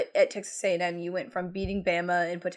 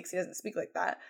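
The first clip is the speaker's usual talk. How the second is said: ordinary delivery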